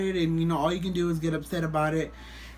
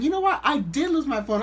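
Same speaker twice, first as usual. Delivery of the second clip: high, raised mock-indignant voice; retold confrontation; 9 semitones higher, 4 dB louder than usual. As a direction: confront him, pitched right up. Acted confrontation with the stranger.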